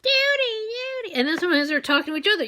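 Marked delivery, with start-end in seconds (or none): 0.0-1.1 high chirping "Judy" call; high mimicked birdsong; bird call imitation. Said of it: "sounds like it's going".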